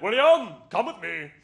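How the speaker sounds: hammed-up English dad calling his son; southern English dad. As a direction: call out like a blustering English father. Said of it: "the most southern English man".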